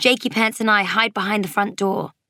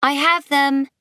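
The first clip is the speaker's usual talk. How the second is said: high answering call; calling back; 7 semitones higher, 4 dB louder than usual. A narrator's reading. Mummy answers Daddy as they leave, calling back high. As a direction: call back across the house. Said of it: "Mummy replies".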